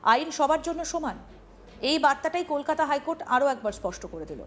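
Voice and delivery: ordinary talk, nothing marked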